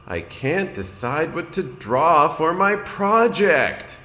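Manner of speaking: high, slow and troubled; a worried little turtle who can't choose; fretful young Franklin. A narrator's reading. Franklin voiced, fretting.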